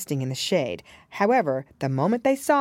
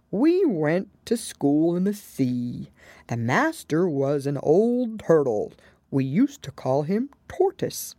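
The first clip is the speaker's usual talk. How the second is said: sobbing a little. A narrator's reading. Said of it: "still sobbing a little".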